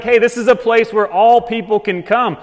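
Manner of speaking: loud, insistent invitation; emphatic welcome. Acted. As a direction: announce it openly to everyone. Acted open welcome to all people.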